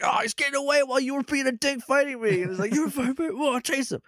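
high, quick bickering voices; squabbling villains. Retold or imitated. Recapping the Misers bickering as the prisoner escapes, high and fast. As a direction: snipe at each other like cartoon brothers.

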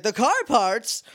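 high mock-puzzled question. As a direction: ask it like a confused cartoon. Mocking character dialogue.

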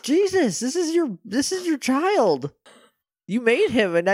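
very high, incredulous scolding; high-pitched protest, drawing out the last word; appalled disbelief. Pretend. Scolding Vexen for betraying his own creation, appalled disbelief. Outraged reaction to creator's callousness.

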